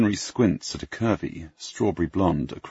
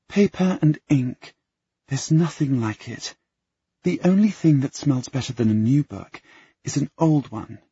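put-on dreamy girl; 8 semitones higher, 3 dB louder than usual. Sarah rhapsodizes about books.

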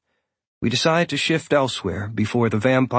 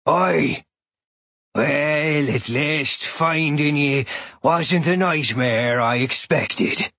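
put-on low growly Irish old man; deep growly voice; 3 semitones higher, much slower than usual. A narrator's reading. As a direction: growl it out slowly like a gruff elder. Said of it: "a deep, growly voice says".

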